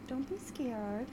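high, slow, soft coaxing; playful reassurance. Pretend. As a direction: coax a timid listener gently, in play. Coaxing an imagined lone fan.

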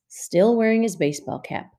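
ordinary delivery, nothing marked

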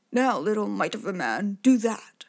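whispered then loud giant taunt; dim giant cyclops. Acted. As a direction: hiss then bark like a dull giant.

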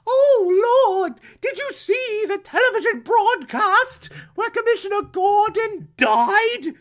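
put-on high, shrieking British accent; British Aunt Harriet. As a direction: wail hysterically in a hammed-up British accent. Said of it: "I've made her British".

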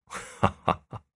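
a breathy unhurried laugh; easy relaxed chuckle before speaking; soft chuckle. Acted. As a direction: laugh it off, calm and amused. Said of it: "耿知府还是淡然一笑说".

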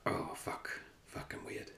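whispered, mock inner voice cursing at himself; hushed self-talk on hearing yourself back; imagined self-critical whisper. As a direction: whisper it like your own harsh inner critic. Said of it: "it might just be that".

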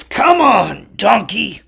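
high, straining call to a donkey; exasperated urging. Acted. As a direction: urge it on in acted frustration. Bilam pulls at donkey's rope.

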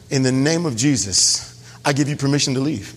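low, measured command; calm authority. Pretend. Believer gives the enemy permission to leave, calm low authority.

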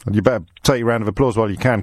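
normal talking voice

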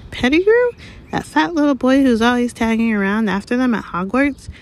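astonished query in a high woman's character voice; incredulous. Acted. Madame Rosmerta to Fudge on hearing Pettigrew's name, incredulous.

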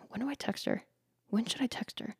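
imitated anxious quiet question; hushed eager asking. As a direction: ask it small and secretive. Imitating him asking the bartenders.

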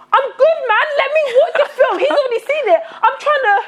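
high, laughing mock exasperation; exasperated protest. Acting out annoyance at chatty friend.

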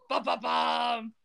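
slow, vocalised cliffhanger music; dramatic sting imitation. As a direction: intone a mock suspense jingle, drawn out.

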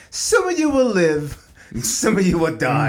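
slow, grand, dismissive decree; Lord Farquaad. Retold or imitated. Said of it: "Remember Lord Farquaad from Shrek".

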